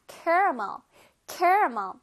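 caramel in three beats, KEH-ruh-muhl; own preferred pronunciation. Shown as a demonstration. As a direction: open KEH at the start of caramel, stressed. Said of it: "선생님은 caramel라고 합니다".

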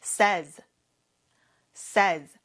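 says said as "sez" with a short e; teacher modelling says as sez, dropping the y sound. Shown as a demonstration. Korean learners hear the teacher model says as sez. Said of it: "그럼 발음이 says로 바뀝니다".

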